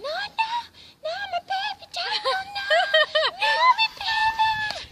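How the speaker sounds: a mum wailing over her fainted son; mock wailing. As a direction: wail like a frantic mother. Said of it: "My mum's there going".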